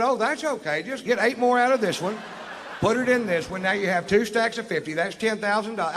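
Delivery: high, loud comic exasperation building to a yell; worked-up exasperation. Retold or imitated. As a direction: lose patience, pitched right up. Exasperated as he teaches cop counting.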